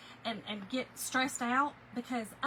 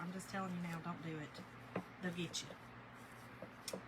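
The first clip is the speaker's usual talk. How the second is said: hushed warning; 6 semitones lower, 12 dB quieter, slower than usual. Real, not acted. Warning viewers about Walmart.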